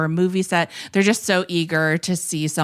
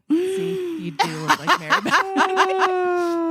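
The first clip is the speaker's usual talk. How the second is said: gloating; 12 semitones higher, slower than usual. Retold or imitated. Husband gloating, told you so.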